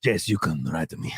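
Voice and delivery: low-pitched talking horse; hammed-up horse voice, dropped low. Horse inviting a rider, low talking-horse voice. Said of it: "I speak like a horse".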